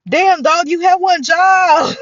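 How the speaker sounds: loud, high comic yell; mock outrage. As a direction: holler it, drawing out the last words. Mock outburst at the pizza place.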